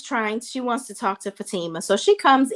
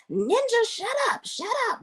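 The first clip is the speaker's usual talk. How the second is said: mock yelling; 13 semitones higher than usual. Belinda shouting down Zach mid-fight, high mock yell. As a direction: snap shut up at him in a high retold yell. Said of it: "like, yelling at Zach".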